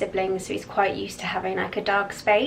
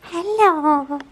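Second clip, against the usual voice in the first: sing-song puppy voice, high and slow; high cooing pet voice; 9 semitones higher, 4 dB louder, much slower than usual. Real, not acted. Greeting the puppy.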